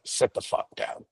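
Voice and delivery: low, soft, breathy menacing order; hushed threat. Retold or imitated. Coach on the bus to his wrestling player, hushed menacing order. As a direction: hiss it through the teeth like a fed-up coach.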